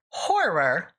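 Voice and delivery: "horror" in two distinct syllables, HOR-ror; carefully enunciated horror. Shown as a demonstration. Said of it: "I really have to enunciate".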